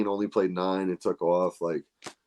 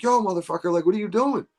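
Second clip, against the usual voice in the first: retold yell; 9 semitones higher than usual. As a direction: yell at the kid who ignored them. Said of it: "we fucking were screaming at this kid".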